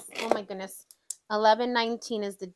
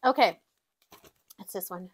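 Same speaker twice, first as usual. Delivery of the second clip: normal talking voice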